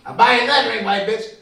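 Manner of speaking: high, loud comic retort; mock indignant offer. Drinker to drink's owner, mock indignant offer. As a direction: throw the offer out loudly.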